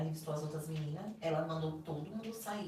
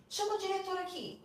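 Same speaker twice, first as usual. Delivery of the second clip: much higher voice, imitating an indignant summons; Eduardo, retold angry demand; 11 semitones higher than usual. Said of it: "ele era muito estressado, bravo, briguento".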